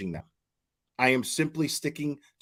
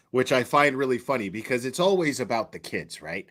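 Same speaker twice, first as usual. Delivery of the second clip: ordinary talk, nothing marked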